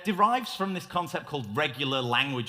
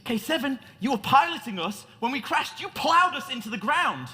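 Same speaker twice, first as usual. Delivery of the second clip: higher, a touch of hammed-up blame; mock angry; 8 semitones higher, 3 dB louder than usual. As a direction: scold the droid for the crash. Captain blaming the droid.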